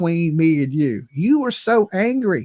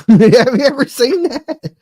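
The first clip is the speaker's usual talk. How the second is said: loud question through laughter; laughing; 6 semitones higher, 7 dB louder than usual. Real, not acted. Streamer mocking his own rapper idea, laughing. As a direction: crack up while asking.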